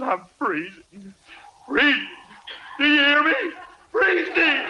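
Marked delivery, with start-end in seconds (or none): 1.7-4.6 high, frantic outcry; worked up. Acted. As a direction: wail in a rising panic about the cold. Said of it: "What are you getting excited about?".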